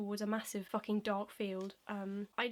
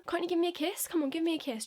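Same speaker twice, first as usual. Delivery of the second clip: loud, higher wheedling plea; imitated pushy suitor; 6 semitones higher, 5 dB louder, faster than usual. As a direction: pester for a kiss, mocking him. Imitates pushy man begging kiss.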